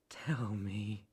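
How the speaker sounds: low, hushed command; menacing whisper. Acted. Captor presses the silent rabbit, menacing whisper. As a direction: whisper the order.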